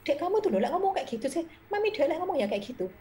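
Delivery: high, loud mock scolding of her child; mother scolding her kid, high and loud. Mother correcting her child, voiced in a high loud scold. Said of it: "saya dulu yang menyadarkan juga anak".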